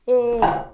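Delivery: a hammed-up yelp as he tumbles; mock falling cry. Reenacting Michael falling off the balcony, mock yelp. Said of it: "kinda like this".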